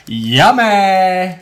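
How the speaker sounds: loud, high, hammed-up cheer; mock cheerful. Last words before eating chip.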